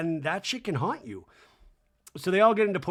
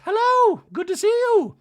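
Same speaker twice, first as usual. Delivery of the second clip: very high, loud air-kiss hello; mock gushing greeting; 9 dB louder than usual. Dorit arriving at the spa, very high loud gushing hello. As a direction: sing out the greeting like a glamorous arrival.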